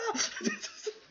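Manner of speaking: high-pitched burst of laughter; risa aguda. Real, not acted. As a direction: cackle high at your own joke. Laughing at his own joke. (carcajada aguda)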